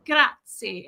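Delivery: mock-fancy attempt at Italian; lightly put-on fancy Italian; trying to sound fancy. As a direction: say it like you're showing off your one Italian word. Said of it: "I try to look fancy".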